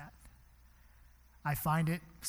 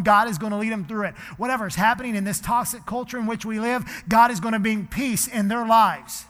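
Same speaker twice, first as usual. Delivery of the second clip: loud, high, fervent preaching; full-voiced revival prayer; preaching at full force; 6 semitones higher, 13 dB louder than usual. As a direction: pray it out over the whole room with fire.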